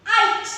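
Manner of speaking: आइट said loud, long I then t; blended sound. Shown as a demonstration. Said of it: "the pronunciation will be". (आइट को ज़ोर से जोड़कर)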